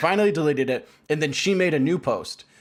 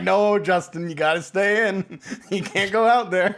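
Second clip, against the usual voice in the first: mock parent scolding; 5 semitones higher than usual. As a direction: play the parent scolding Justin to stay indoors. Parents voiced keeping Justin inside.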